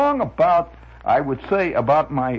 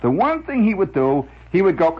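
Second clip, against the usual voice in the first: usual speaking voice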